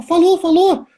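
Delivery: high-pitched delighted confirmation; excited. Retold or imitated. Father assuring his little son he said it, excited. Father thrilled at son's first R.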